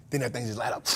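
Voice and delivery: normal talking voice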